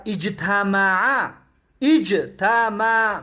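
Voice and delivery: اجتماع drawn out as ij-ta-ma-'a; very slow اجتماع, each syllable apart. Shown as a demonstration. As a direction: break اجتماع up to show its letters. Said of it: "میم ما قبل آخره".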